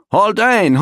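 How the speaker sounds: loud high call of "Halt ein"; "Halt ein" shouted high and loud, falling; first shout of halt. Acted. Repeated call of the guard.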